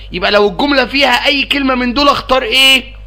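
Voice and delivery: ordinary talk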